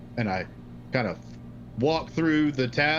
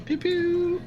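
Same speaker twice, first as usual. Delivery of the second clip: pew pew sounds. Pretend. Laser gun noises.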